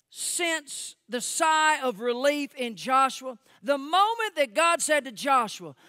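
high, loud, driving preaching; full-force preaching. Real, not acted. Preacher voicing God's promise to Joshua, full-force preaching. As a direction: preach God's words loudly and emphatically.

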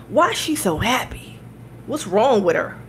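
mock-exasperated man asking what's wrong; put-on indignant bafflement; baffled annoyance, imitated. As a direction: imitate an irritated worker, stretching the final question. Imitating baffled, annoyed sandwich worker.

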